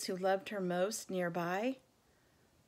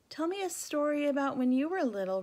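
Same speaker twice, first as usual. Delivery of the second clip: higher, louder, fast eager plea; pleading; 7 semitones higher, 6 dB louder, faster than usual. Acted. Little elephant pleading with Ivan, fast and eager. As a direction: beg him for a story. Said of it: "Ruby pleads".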